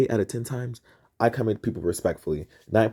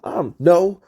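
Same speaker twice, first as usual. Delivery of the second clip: ordinary delivery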